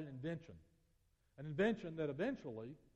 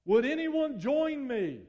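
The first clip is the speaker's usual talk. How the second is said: very loud, high triumphant shout, slowing at the end; booming triumphant announcement; yelling as loud as he can; 8 semitones higher, 16 dB louder, slower than usual. Acted. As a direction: call out to the whole crowd in triumph. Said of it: "he would yell as loud as he could".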